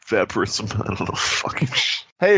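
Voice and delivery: ordinary talk, nothing marked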